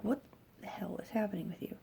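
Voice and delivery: retold hushed, quick disbelief; bewildered undertone. Mother to daughter under the faucet, baffled undertone. Retelling reaction to daughter's odd behavior.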